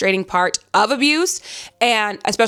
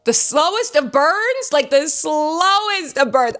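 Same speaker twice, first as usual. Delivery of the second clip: higher, slow and drawn-out, stretching slowest and burns; savoring emphasis; 6 semitones higher, slower than usual. Real, not acted. Reader lamenting the slow burn to viewers, drawn-out savoring. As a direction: stretch it out like you're starving for it.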